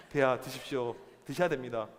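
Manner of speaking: low-pitched courtly pleading; deferential coaxing. Acted. Queen urging sulking king to eat, deferential coaxing. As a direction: urge royalty calmly and formally.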